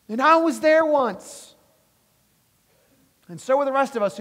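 loud, high, driving sermon delivery; full-force preaching. Acted. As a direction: preach it hard to the congregation.

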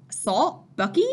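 incredulous questions, rising high on the name; skeptical. A narrator's reading. Father doubting Bucky's assault charge, skeptical. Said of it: "His skeptical tone matched my thoughts".

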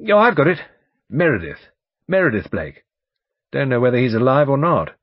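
loud, brisk barrister recalling names; blustering lawyer, chatty. Acted. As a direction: chat loudly like a self-assured old lawyer.

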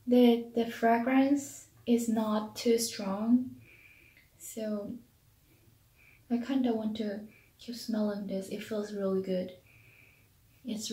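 muffled behind hand and jar; sniffing while speaking; talking through covered mouth. Real, not acted. Seen 2: Hand and jar cover mouth and nose while sniffing; brief gap near 2.4.

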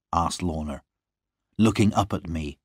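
usual speaking voice

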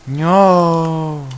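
loud, high cry of dismay; anguished no. Real, not acted. Streamer crying out at his broken world, loud and high. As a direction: wail it as everything is lost. Progress seems lost forever.

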